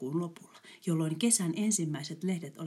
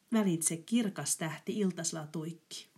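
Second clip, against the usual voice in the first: plain reading voice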